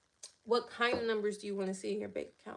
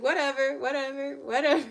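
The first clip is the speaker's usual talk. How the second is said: dismissive whatever; 3 semitones higher, 9 dB louder than usual. Retold or imitated. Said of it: "She's like".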